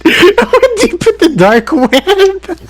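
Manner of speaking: high, slow, laughing through it; laughing joke. Real, not acted. Answering the friend's teasing, laughing slowly. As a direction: play along while cracking up.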